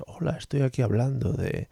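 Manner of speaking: a hint of a soft-spoken science host; Punset voice. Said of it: "como nuestro amado Punset".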